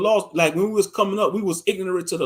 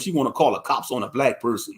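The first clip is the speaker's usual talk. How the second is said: ordinary delivery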